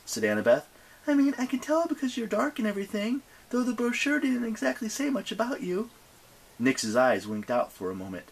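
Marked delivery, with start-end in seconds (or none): 1.1-5.9 high-pitched, quick chatty heroine voice; feigned-casual young heroine. Acted. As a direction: prattle on in a high put-on voice.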